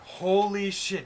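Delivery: loud, drawn-out fake amazement; mock astonishment. Feigning amazement that livers are the same color, mock astonishment. Mock discovery that organs match.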